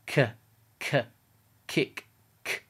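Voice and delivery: K with a puff of air; aspirated K. Shown as a demonstration. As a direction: say K, as in "kick", letting air burst out. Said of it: "try to feel if air comes out".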